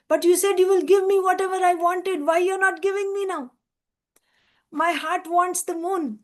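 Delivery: put-on high-pitched, pleading little boy; demanding child. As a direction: plead like a child holding mother to a promise. Small boy demands the promised moon.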